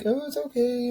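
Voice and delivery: high, soft motherly comfort; mom's soothing voice. Retold or imitated. Son retells mom consoling him, in a high soft voice. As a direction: imitate mom saying it's okay, gently. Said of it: "My mom's like".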